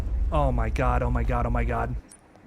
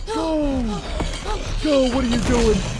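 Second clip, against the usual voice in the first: urgent yelling; 10 semitones higher, slower than usual. Real, not acted. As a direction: push them to run, frantic. Urging character to run.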